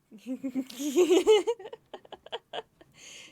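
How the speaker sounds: loud, high squealing laugh; laughing fit. Real, not acted. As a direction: crack up helplessly, pitch climbing. Cracks up after acted quote.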